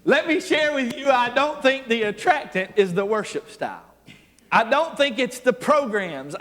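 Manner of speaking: full-force revival preaching. Acted. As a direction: drive it home from the pulpit.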